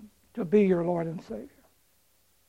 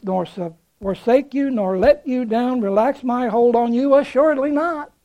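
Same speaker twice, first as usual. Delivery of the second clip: preaching at full force; 5 dB louder than usual. Acted. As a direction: proclaim the promise with rising force.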